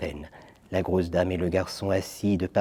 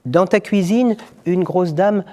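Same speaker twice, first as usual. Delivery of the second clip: put-on the boy; 8 semitones higher, 4 dB louder than usual. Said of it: "dit le garçon".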